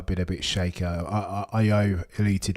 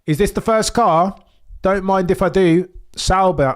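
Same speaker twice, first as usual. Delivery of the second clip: loud and high with excitement; excited shout; 9 semitones higher, 8 dB louder than usual. Real, not acted. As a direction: yell it out thrilled. First car reveal excitement.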